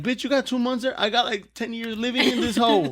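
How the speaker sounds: high, indignant disbelief; incredulous rant. Retold or imitated. Speaker voicing own reaction.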